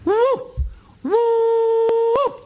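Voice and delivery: high falsetto hooting woo calls; loud high-pitched call into the night; bunyip mating call. Retold or imitated. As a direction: hoot it like an animal calling in the bush. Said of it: "I remembered the mating call".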